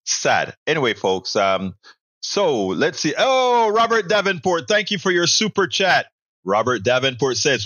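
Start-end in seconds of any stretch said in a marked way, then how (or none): 3.2-6.1 excited thanks. Real, not acted. Host greets a super chat donor.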